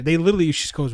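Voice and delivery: ordinary talk, nothing marked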